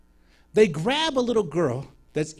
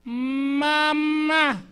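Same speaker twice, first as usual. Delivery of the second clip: put-on high, drawn-out child's call; a child's first word, high and long; healed girl calling her mother, high; 10 semitones higher, 4 dB louder than usual. Deaf girl's first word.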